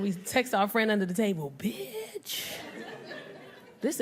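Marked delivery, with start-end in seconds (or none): 1.6-2.5 hissed aside. Retold or imitated. Texting friend under table.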